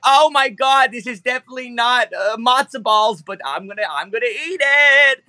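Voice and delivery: high squealing dinosaur voice, way up; high whiny creature voice. Acted. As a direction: complain but dig in anyway, pitched high. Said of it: "He's like".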